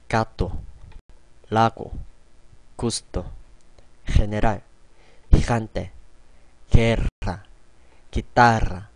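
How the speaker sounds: gato, lago, gusto with a hard g, general and gigante with a breathy h; hard g before a o u, throaty h before e i; Spanish G demo. Shown as a demonstration. Teacher reading G word list to learners, clear demo. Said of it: "발음 한번 해보도록 하겠습니다".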